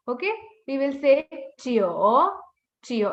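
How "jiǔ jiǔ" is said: CHIO ending in a long o, like "jyoh"; correct CHIO vowel. Shown as a demonstration. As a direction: draw CHIO out to an oh. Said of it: "It's not Chiu".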